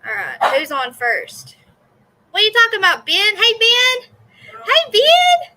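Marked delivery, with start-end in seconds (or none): 2.3-3.4 raised voice across the house; calling out. Real, not acted. Calling out to son Ben. 3.4-4.1 calling out. Real, not acted. Calling out to son Ben. 4.6-5.5 very high, loud shout of a name. Real, not acted. Calling out to son Ben.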